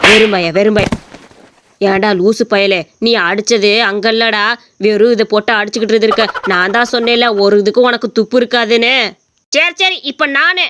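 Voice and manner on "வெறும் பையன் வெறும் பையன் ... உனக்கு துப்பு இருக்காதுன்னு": put-on lower, contemptuous old man; old father mocking the would-be son-in-law; scornful old father. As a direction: sneer at the useless son-in-law.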